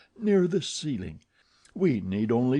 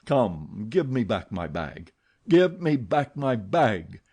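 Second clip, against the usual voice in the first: louder threatening command; menacing demand; 4 dB louder than usual. Acted. Eric threatening Christine over the key. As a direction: warn her, then demand the bag.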